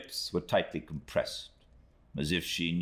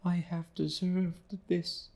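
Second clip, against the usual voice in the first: put-on much higher, pleading young woman's voice; pleading exclamation; slower than usual. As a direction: beg for mercy in a high, fragile young woman's voice. Emily answers humbly.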